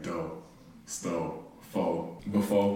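DO, STO, FO, BEFORE with endings dropped to a bare "oh"; cut-off endings. Shown as a demonstration. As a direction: clip DO, STO, FO, BEFORE to end on "oh", softly. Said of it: "I just cut out".

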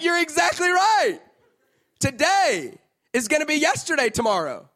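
amazed dad. Retold or imitated. Dad marveling at his daughter's words, loud and amazed. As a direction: gush like it's a huge revelation. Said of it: "I was like".

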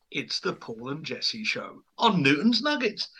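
slow, grand announcer read; show announcer. Acted. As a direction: present the show's name proudly. Show intro.

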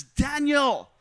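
high, loud retold call. Little girl spots him on the dirt road, calling out.

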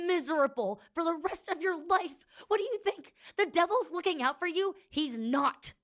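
high and loud, rising anger; furious threat. Acted. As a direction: raise your voice and pile on. Griana's threat rises.

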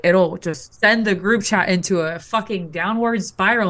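normal talking voice

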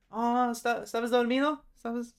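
high, gentle mom voice on the phone; playing mom on phone. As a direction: sound like mom calling in the morning. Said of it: "pretend you're picking up the phone".

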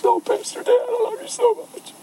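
very high mock-tearful voice; high-pitched weepy imitation; weeping. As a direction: imitate her gushing through tears. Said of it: "she weeps when we bump into".

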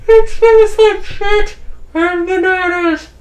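high, slow put-on voice; slow squeaky character voice. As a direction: drawl it out in a silly character voice. GM voicing a character.

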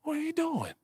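high, soft sneering voice of doubt; a doubter's high, soft taunt; mocking inner doubter. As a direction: play the scoffing voice in your head.

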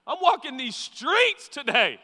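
high, slow, swaggering; cocky healed man. Acted. Healed man brushing off the questioners, high swagger. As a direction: strut through the words, loud and high. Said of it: "He was like".

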